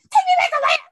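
hammed-up outrage, half laughing; furious shout. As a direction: yell it at the friends who laughed. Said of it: "I was so mad".

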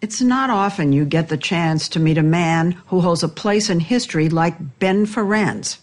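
usual speaking voice

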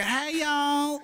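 high Southern drawl greeting; hammed-up Southern twang, pitched high; mock thick Southern drawl; exaggerated Southern accent. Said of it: "Southern drawl, but it's not like".

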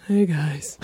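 low put-on pet voice; Scout. Host showing how Scout would sound, low put-on voice. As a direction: drop the pitch way down for a greeting. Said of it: "I think Scout's, she's got a, like".

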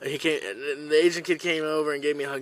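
ordinary talk

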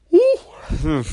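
small yawn; a short yawning sigh. Real, not acted. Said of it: "disculpe usted si estoy bostezando". (bostezo corto)

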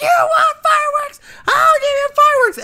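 high, loud mock shout of a furious woman; mock furious Maria. Woman threatening her friend, high mock shout. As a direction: shriek it like a woman about to smash plates. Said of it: "And Maria's like".

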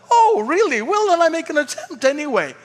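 high-pitched mock surprise; exaggerated high "oh, really?" lilt; mock captain's reply. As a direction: play the captain suddenly giving in, sarcastically. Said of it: "Like the captain was like".

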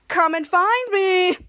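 slow, sing-out teasing call; playful taunt. Retold or imitated. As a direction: tease them like hide-and-seek. Invisible character taunts his pursuers.

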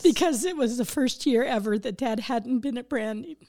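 tearful laugh, voice close to breaking; laughing through tears, holding back sobs; snotty crying. Real, not acted. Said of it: "I know it's an ugly cry".

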